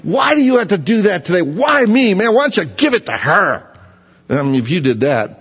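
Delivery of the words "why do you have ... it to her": much higher, hammed-up whiny complaint; mock whining. Worker whining to boss about a raise, mock whine. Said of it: "And you thought".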